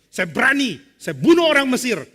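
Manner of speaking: loud, high-pitched bravado; bold boast. Acted. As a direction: declare courage with full confidence. Moses boasting with backing, acted. (sesumbar lantang)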